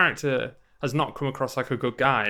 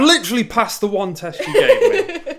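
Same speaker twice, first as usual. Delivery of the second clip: higher, louder, laughing indignation; mock protest; 7 semitones higher, 5 dB louder than usual. Player objects to Saeed's distrust, laughing exasperation. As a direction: protest in amused disbelief. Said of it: "You're like".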